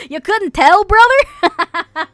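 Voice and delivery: loud, high country American drawl; hammed-up American accent. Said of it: "I need to talk more like an American".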